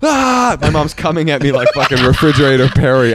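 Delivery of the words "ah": high loud yelp; startled yelp. Retold or imitated. Son on the toilet yelping as mom charges. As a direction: shriek in panic. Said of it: "and I go".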